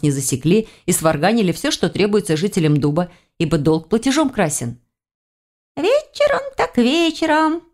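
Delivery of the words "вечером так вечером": high, slow creature voice; agreeable little haggler. A narrator's reading. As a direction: agree in a drawn-out piping voice. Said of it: "согласился торгаш".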